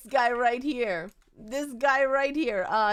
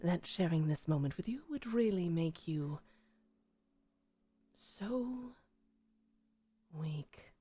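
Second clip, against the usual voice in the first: low, soft sneering tease; taunting vampire; 7 semitones lower, 15 dB quieter than usual. Acted. Marius mocking weak Jonathan, soft low taunt. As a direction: purr it low, savouring his weakness.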